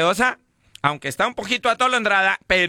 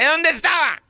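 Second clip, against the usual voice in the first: higher demanding wife; 10 semitones higher than usual. Retold or imitated. Wife demanding where the drunk husband was, higher. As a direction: demand an answer.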